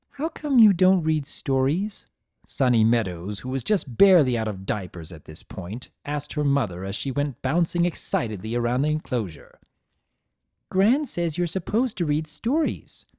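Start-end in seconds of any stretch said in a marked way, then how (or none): 0.1-2.1 put-on high, curious child voice; eager little girl; little filly Sunny. 10.7-13.1 put-on high, curious child voice; eager little girl; little filly Sunny.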